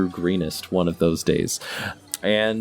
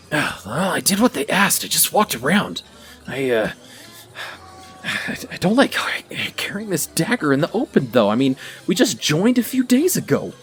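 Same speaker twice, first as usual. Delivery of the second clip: put-on higher, worried young voice; nervous recruit; 7 semitones higher than usual. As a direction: fret like an uneasy new recruit. Nervous cult recruit overheard.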